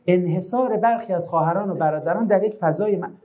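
unhurried bureaucratic courtesy, put on; mock formal announcement. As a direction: calmly read out an emergency as a formal notice. Parody of overly polite fire announcement.

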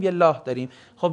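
ordinary talk, nothing marked

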